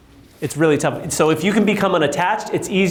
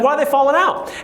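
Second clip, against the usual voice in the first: high, plaintive "why are they falling out"; mock rookie agent, pitched up; whiny new agent; 9 semitones higher than usual. New agent whining question.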